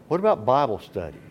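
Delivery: normal talking voice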